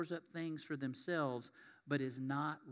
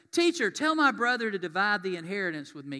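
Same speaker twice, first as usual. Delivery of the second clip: loud, higher-pitched call over a crowd; yelling out; 4 semitones higher, 10 dB louder than usual. Acted. Heckler in the crowd interrupting Jesus as he teaches, yelling out. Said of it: "somebody in the crowd yells out something".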